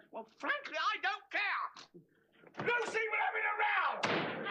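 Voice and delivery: high, loud, building to a yell; defiant shouting. Acted. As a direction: shout it up the stairs for all to hear. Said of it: "heard you shout something up the stairs".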